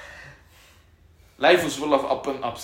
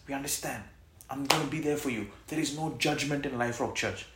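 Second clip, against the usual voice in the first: ordinary delivery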